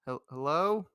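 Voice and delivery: calling out. Acted. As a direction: call out loudly to see who answers. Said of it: "I'm gonna call out".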